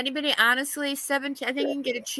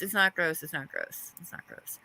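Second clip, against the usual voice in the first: each repeat softer, ending whispered; fading to a whisper; 5 semitones lower, 8 dB quieter than usual. Shown as a demonstration. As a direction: let it fade into a whisper. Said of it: "repeats fading to a whisper".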